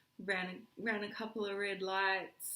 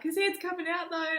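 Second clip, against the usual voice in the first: urgent protest; 10 semitones higher, 7 dB louder than usual. Retold or imitated. Protesting to the midwives.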